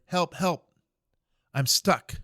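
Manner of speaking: mock yell for help from the bottom of a hole; a private stuck in a hole calls up for help, in a mild mock yell; retold cry for help. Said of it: "he starts screaming up there".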